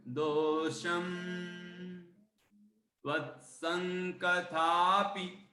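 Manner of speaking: word by word, very slow; slow teaching recitation. Shown as a demonstration. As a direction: pause after each word so they can repeat. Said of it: "मैं बोलूंगा, आप दोहराना".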